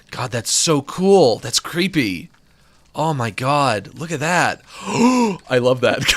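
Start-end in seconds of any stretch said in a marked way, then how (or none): 2.9-6.1 slow, hushed after a gasp; slow awestruck I love that; awed gasp. Real, not acted. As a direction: breathe it out in awe. Gasps at the spider's gaze.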